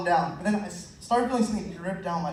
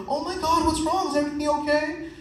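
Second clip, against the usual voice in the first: higher, concerned crowd voice; worried partygoers; 7 semitones higher than usual. Retold or imitated. Party guests rushing over to check, high mock concern.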